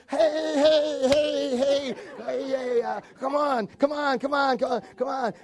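cheerful hey hey come on, hammed up; eager calling out. Adam and Eve eagerly calling to God. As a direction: call out like excited kids.

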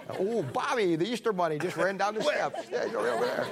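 teasing through real laughter; laughing. Real, not acted. Preacher to Bob, laughing while teasing. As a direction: chuckle out the joke to a friend.

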